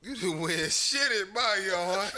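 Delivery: imitating while laughing; angry homeowner. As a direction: stretch it out like the old man.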